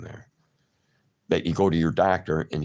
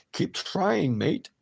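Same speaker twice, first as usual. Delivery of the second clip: mock Aussie; 11 semitones higher, slower than usual. Shown as a demonstration. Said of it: "shit accent".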